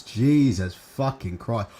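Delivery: drawn-out, low, disbelieving curse; exasperated groan. Real, not acted. Streamer despairing at the fight card, slow exasperated drawl. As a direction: stretch it out slowly in weary disbelief.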